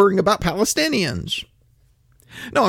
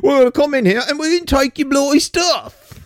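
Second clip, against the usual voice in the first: higher, hammed-up British accent; mock British accent; 10 semitones higher than usual. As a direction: play a cheeky British invader, pitched up. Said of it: "You say what you want about the British Empire".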